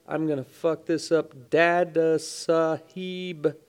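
ordinary talk, nothing marked

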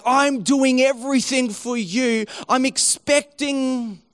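high-pitched retold complaint; mock indignant plea, slowing at the end; frustrated with God. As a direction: voice a believer demanding payback. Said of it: "become frustrated with God".

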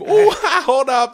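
slow, laughing through the words. Pretend. [name] laughing at her college nickname joke. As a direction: crack up at your own line.

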